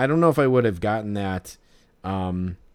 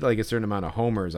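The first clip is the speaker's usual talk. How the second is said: ordinary talk, nothing marked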